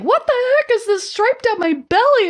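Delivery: high-pitched retold outburst; mock disbelief. Woman recounting finding a stripe on her belly, mock disbelief. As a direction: exclaim it high, as if baffled.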